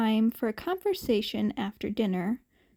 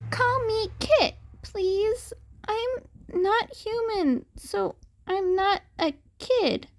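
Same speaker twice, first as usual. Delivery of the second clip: put-on insistent kid; 9 semitones higher than usual. A narrator's reading. Kitten boy corrects name.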